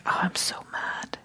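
acted soft, deflated sigh of anger; quietly caving in, mock defeat; collapsing. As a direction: let the words sag, hushed and breath-heavy. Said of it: "it could also be a collapsing".

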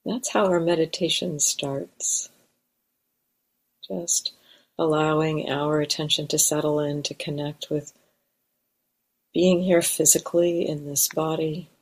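calm, unhurried meditation guidance with long pauses; guided meditation. Real, not acted. Teacher guiding meditators to arrive in the body, guided meditation. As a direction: settle listeners gently, leaving space between phrases.

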